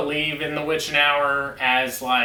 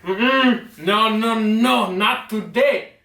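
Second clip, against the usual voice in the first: a lightly voiced inner voice, higher, slowing on not today; nagging inner voice; 5 semitones higher, 3 dB louder, much slower than usual. A hint of his inner voice refusing him. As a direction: play the stubborn little voice in his head. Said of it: "That little voice is getting".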